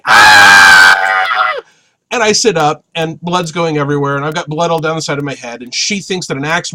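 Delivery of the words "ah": wife yelling back in a deep alarmed howl; wife's yell. Retold or imitated. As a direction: lower loud panicked yell. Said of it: "And she's yelling".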